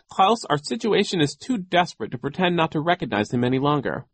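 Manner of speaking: high, urgent appeal; desperate plea. A narrator's reading. Sister urges her brother to stop pretending, desperate.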